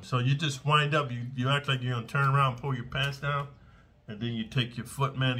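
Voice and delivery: ordinary talk, nothing marked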